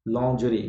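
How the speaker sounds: लॉन्जरी quietly as "lon-zhuh-ree"; correct pronunciation. Shown as a demonstration. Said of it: "लॉन्जरी बोलना है".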